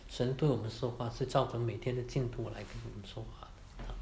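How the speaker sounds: hushed, unvoiced whisper. Real, not acted. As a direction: drop into a whisper to draw them in. Stressing how God speaks daily. (压低声音耳语)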